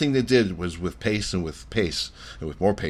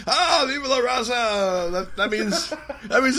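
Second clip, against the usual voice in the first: slow, much higher mock commentator cry; Taz impression; slower than usual. Cohost imitating Taz calling the frog splash, high and slow. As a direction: ham up the announcer's yell, stretched out. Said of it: "and then Taz goes".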